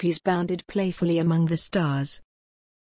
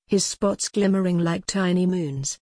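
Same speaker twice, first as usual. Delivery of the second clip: plain reading voice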